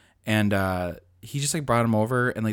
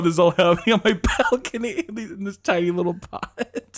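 ordinary talk, nothing marked

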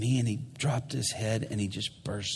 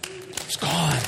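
higher, fuller, rising joy; joyful release; 5 semitones higher than usual. Retold or imitated. Prisoner rejoicing to the preacher that his shame is gone. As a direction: let the relief burst out.

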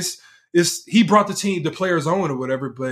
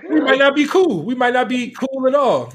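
mock player voice; 9 semitones higher, 3 dB louder than usual. Voicing Harden to teammate.

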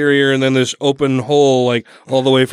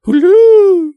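hooting Hulu; 7 dB louder than usual. Pretend. Teasing Hulu pronunciation.

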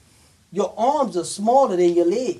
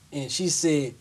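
ordinary delivery

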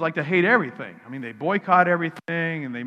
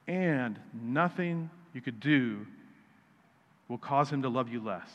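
soft sincere assurance; 4 semitones lower, 6 dB quieter than usual. Real, not acted. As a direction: lower your voice to reassure the congregation. Preacher's key message, softened.

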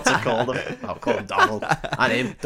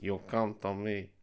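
very soft, lower, Indian-sounding mobster; failed Godfather accent, sounding Indian; 4 semitones lower, 13 dB quieter than usual. Acted. Co-host attempting the line he was fed, soft botched accent sounding Indian. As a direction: murmur a botched don that drifts into an Indian accent. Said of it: "That sounded Indian".

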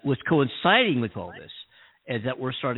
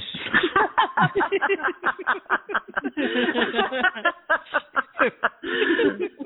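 evil laugh; 4 dB louder than usual. Acted. Evil laugh after villain line.

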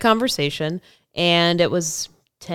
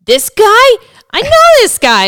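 much higher, louder disbelief; surprised shout; 12 dB louder than usual. Retold or imitated. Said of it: "he's like".

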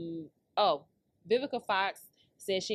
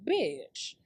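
usual speaking voice

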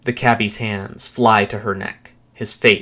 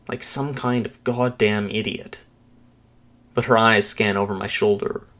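ordinary delivery, nothing marked

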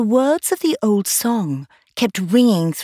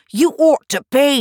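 Tweedledum voice; 8 semitones higher, 5 dB louder than usual. A narrator's reading. Tweedledum voiced in read story.

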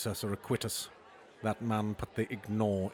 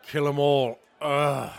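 triumphant guess; 5 semitones higher, 8 dB louder, slower than usual. Acted. King shouting the charades answer.

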